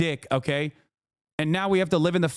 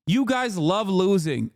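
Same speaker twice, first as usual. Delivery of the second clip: ordinary delivery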